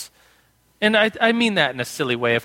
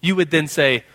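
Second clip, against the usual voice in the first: ordinary delivery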